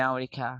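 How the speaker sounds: আমেরিকা as "mea-rikha"; British pronunciation. Shown as a demonstration. Teacher answering how the British say it, "mea-rikha". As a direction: drop the first vowel of আমেরিকা, aspirate the k. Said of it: "ব্রিটিশে কি বলে".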